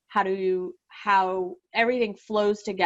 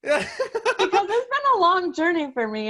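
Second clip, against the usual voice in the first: higher, laughing through rising emotion; emotional laugh; 11 semitones higher than usual. Real, not acted. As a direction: laugh it out as tears come. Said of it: "you are getting emotional".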